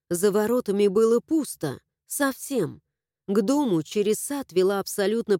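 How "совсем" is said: plain reading voice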